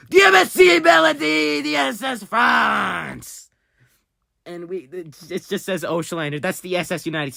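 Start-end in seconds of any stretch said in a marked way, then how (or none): 0.1-3.5 high, loud announcing cry; excited shout. Real, not acted. Finding famous ships in the game, thrilled shout. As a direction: shout out each ship name with glee.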